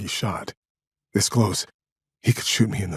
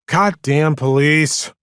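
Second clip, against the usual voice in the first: slow, louder exclamation of defeat; resigned; 8 semitones higher, 7 dB louder, slower than usual. A narrator's reading. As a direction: give up with a loud, drawn-out curse. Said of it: "said in a resigned voice".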